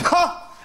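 one sharp high "Ha!" with no follow-up; imitating a friend's one-shot laugh; single bark laugh. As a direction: fire off a lone high laugh and stop dead. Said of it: "he just laughs once".

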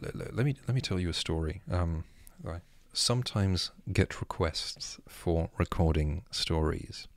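ordinary talk, nothing marked

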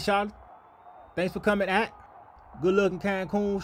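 ordinary delivery

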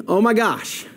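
usual speaking voice

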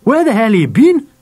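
high, loud angry demand. A narrator's reading. Father confronts runaway son, loud and angry. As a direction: raise the pitch and demand an answer. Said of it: "asked Dad angrily".

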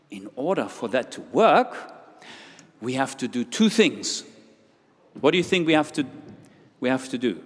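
ordinary talk

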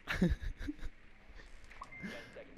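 squeaky unvoiced laugh; a giggle caught out; high breathy giggle. Real, not acted. Laughs while caught typing.

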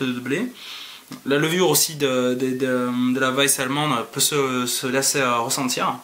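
glass under the nose; sniffing beer while talking; speaking into a glass. Real, not acted. Seen 1: Glass rim held at nose and mouth while speaking, briefly lowered.

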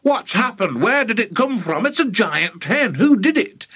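high, agitated rapid-fire questions; flustered high exclaiming; worked up, excited. A narrator's reading. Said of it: "all worked up and excited".

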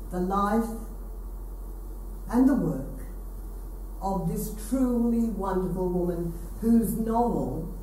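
ordinary talk

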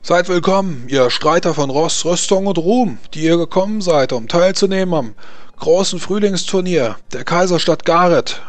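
laut und hoch über die Arena rufen; verkünde es wie ein Herold vor dem Volk; feierlicher Ausruf. Acted. Turniermarschall ruft Begrüßung aus. (Turniermarschall begrüßt die Streiter, laut und feierlich)

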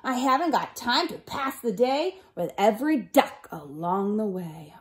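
big bossy truck voice, loudly acted; yelled, pushy dump truck. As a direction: barge through as a rude, self-important truck. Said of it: "yelled a dumb truck".

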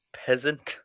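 ordinary talk

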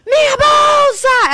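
very high, loud, drawn-out mock shriek; panicked scream. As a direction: shriek as if the bag has vanished. Imitating friend panicking over lost bag. (berro agudo de desespero, imitando)

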